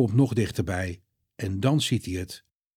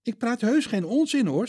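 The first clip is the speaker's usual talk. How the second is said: put-on higher, earnest young woman; Maria's voice; 12 semitones higher than usual. Maria tells Peter and John, higher earnest voice. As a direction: voice Maria, upset and eager.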